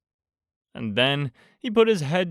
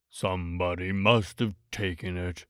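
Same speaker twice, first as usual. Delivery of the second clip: gloomy Eeyore; 6 semitones lower, 6 dB quieter than usual. A narrator's reading. Narrator voicing Eeyore to Pooh, low and glum. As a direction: drag it out like a sad old donkey.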